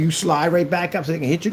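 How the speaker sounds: ordinary talk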